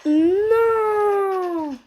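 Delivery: high, loud cry of dismay. Real, not acted. Loses the fight in game.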